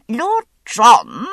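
put-on high, loud, fussy old officer's call; high querulous Admiral. The Admiral calling out to Lord John, high and querulous. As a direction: pipe it up querulously, like a fretful admiral. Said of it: "the Admiral's high, querulous voice".

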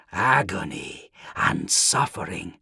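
slow, eerie voice in his head; slow, low demonic voice; whispery telepathic voice. A narrator's reading. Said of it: "said a voice in his head".

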